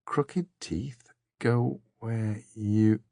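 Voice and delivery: slow, halting musing; puzzling to herself. A narrator's reading. Dor works through riddle clues, puzzling to herself. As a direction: turn the words over one by one. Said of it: "puzzling to herself".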